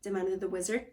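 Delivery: usual speaking voice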